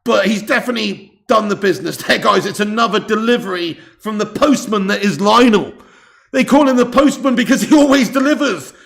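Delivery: loud, high, building to a peak; big punchy commentator delivery; excited commentary. Real, not acted. Streamer telling viewers Messi delivers, loud and excited.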